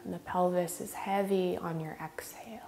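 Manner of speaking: measured meditation lead with long pauses; guided meditation. Real, not acted. Yoga teacher leading students in regulating the breath, guided meditation. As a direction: guide them unhurriedly, leaving space between phrases.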